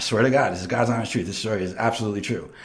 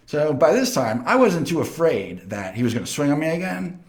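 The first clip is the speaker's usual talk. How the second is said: ordinary talk, nothing marked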